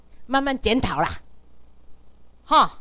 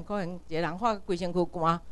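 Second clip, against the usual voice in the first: normal talking voice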